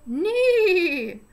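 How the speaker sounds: high, loud neigh; whinny like a horse. Shown as a demonstration. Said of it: "What does the horsey say?".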